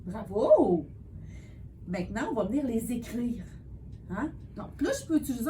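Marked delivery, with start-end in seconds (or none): 0.0-0.9 cheerful praise. Real, not acted. Praising child's answer.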